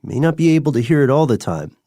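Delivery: ordinary talk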